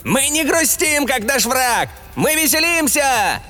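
very high, gleeful shout; joyful yell. A narrator's reading. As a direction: whoop it out like a jester. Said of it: "радостно завопил небритый карлик".

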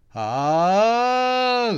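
high, loud scream of terror; terrified scream. A narrator's reading. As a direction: scream in horror. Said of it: "заорал от ужаса Макс".

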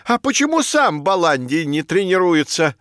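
protest, outraged; indignant. Acted. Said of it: "возмутился Беляк".

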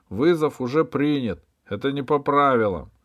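высокий, громкий протест судьи; объяви нарушение на всё поле; громкое возражение. A narrator's reading. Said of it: "воспротивился главный судья". (громкий судейский протест)

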